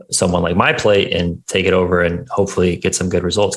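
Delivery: normal talking voice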